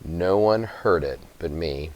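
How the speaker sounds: ordinary delivery, nothing marked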